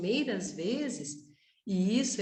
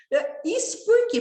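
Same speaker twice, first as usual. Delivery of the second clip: ordinary delivery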